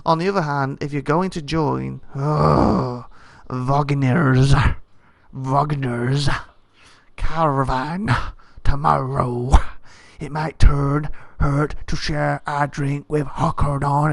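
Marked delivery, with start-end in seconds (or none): 2.1-14.1 slow, drawn-out character voice; odd put-on voice. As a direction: put on a silly voice, stretching the names. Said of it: "why I'm talking like this".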